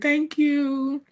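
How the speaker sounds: high, sweet little thanks; imitating the daughter's polite thanks; sweet thank you. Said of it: "she was like".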